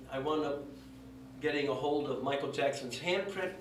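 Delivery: ordinary talk, nothing marked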